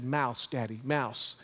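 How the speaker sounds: soft, lightly voiced little toddler; a hint of a small child pointing something out. One-year-old son telling his dad, lightly voiced toddler.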